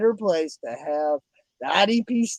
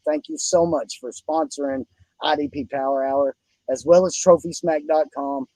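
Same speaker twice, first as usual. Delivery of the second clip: normal talking voice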